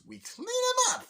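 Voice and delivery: very high, slow squeak; squeaky cartoon line. Mario reassures client in retelling.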